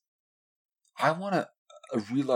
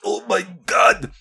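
much higher, louder, slow squeal; hammed-up gushing fan voice; mocking gushing fan; 12 semitones higher, 12 dB louder, slower than usual. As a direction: shriek it like an overexcited fan. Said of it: "they're like".

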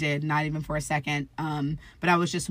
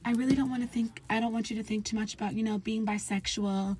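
higher, soft voice for mom; lightly voiced mother, pitched up and hushed; careful mother; 5 semitones higher, 5 dB quieter than usual. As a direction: imitate a mom treading softly. Voicing mom's careful advice.

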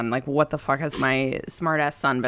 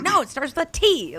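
put-on high whiny kid; 10 semitones higher than usual. Son correcting mom.

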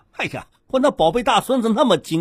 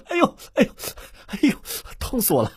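groaning in pain; 6 dB quieter, faster than usual. Acted. Grandpa's back pain flares.

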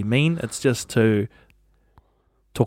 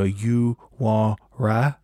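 slow, drawn-out mock accent on "You want rice?"; a light imitation of the lady's accent asking "You want rice?", very slow; imitating her accent; much slower than usual. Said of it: "I'm gonna try and emulate how she said it".

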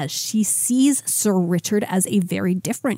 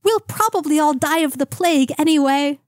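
higher-pitched mock-medieval lad; Doug the Peasant voice; 8 semitones higher than usual. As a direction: stay in the squeaky lovesick boy voice. Still high Doug voice.

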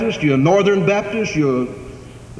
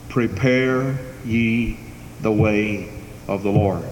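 slow solemn proclamation; 9 semitones lower, 4 dB quieter, slower than usual. Acted. Closing the quote of Isaiah.

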